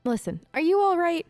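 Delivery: normal talking voice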